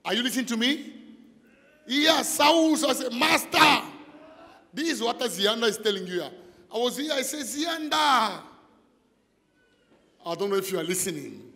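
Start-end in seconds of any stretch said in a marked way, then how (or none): 1.9-3.8 loud, high-pitched shout of Master; shouted reply. Acted. Preacher shouting Saul's answer to the congregation. 7.5-8.4 loud high call of Zianda; calling out a name. Acted. Preacher voicing God calling the woman Zianda.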